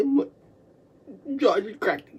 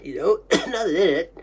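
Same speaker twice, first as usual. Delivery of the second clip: ordinary talk, nothing marked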